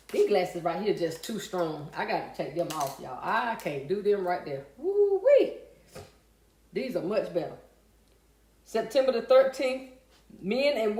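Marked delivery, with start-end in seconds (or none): 4.8-5.5 high, drawn-out "woo-wee"; woo-wee exclamation. Real, not acted. Streamer reacting to too-strong glasses, high woo-wee.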